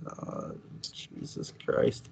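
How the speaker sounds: slow, hushed mock-exasperated groan of words; retold dismay. Host recalls hearing the casting news, hushed dismay. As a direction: drag it out under the breath, dismayed.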